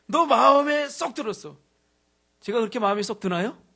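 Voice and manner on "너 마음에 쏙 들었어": 쏙 stretched slow, higher and louder; slow emphasis. Retold or imitated. Preacher re-enacting the senior's words, slow and loud. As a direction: lean on every word slowly. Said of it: "근데".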